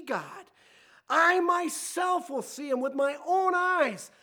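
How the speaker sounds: high, slow, fervent scripture reading; impassioned declaration. Acted. Preacher voicing Job's faith in his Redeemer, impassioned. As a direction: declare it with rising conviction, voice lifted. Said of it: "do you sense the emotion there".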